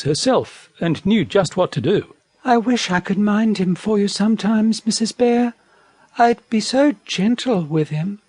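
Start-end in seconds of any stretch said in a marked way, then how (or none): 2.4-8.2 high, lightly voiced wallaby; gentle, hopeful wallaby; wistful wallaby. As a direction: ask sweetly in a raised voice. Wallaby pleading to mind the baby.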